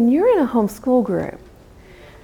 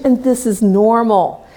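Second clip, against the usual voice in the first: usual speaking voice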